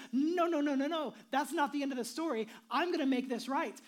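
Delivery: loud, insistent voice of God; God stepping in, loud and insistent; God's loud refusal. Acted. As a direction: cry out no, refusing to give up. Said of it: "And he says".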